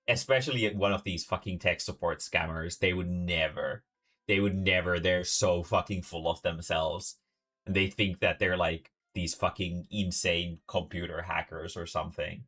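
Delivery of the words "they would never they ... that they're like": ordinary delivery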